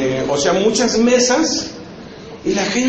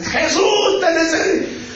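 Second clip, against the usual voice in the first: retold tearful shout; 10 semitones higher than usual. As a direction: cry it out like a desperate prayer. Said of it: "a gritos, llorando".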